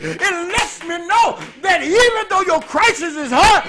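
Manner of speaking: loud, driving sermon delivery; full-force preaching. Acted. Preacher driving home his point, loud and forceful. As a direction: preach it hard to the congregation.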